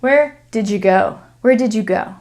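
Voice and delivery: "did you" run into "didja"; words pushed together. Shown as a demonstration. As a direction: blend "did you" with a J sound. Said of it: "they're pushing them together".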